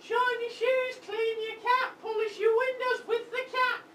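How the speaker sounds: a hammed-up Cockney urchin; bad Cockney street-kid patter; mock Cockney. Urchin introducing himself in mock Cockney. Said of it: "Is that even a Cockney accent".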